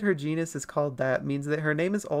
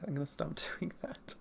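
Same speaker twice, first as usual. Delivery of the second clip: breathy whisper, much softer; 13 dB quieter than usual. Real, not acted. Streamer whispering to self about makeup, hushed. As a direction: murmur it under your breath.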